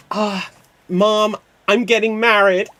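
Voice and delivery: high, gushing announcement to mom; hammed-up daughter bursting with wedding news; excited bride. Plays excited bride in Mamma Mia.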